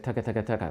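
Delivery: quiet relaxed tucka tucka; tossed-off tucka drill; easy tucka. Pretend. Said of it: "your brain just says".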